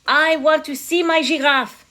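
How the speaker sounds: put-on high, loud French-accented demand; demanding French king. A narrator's reading. King at window to counselor, demanding French accent. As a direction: demand it with royal impatience, mock French.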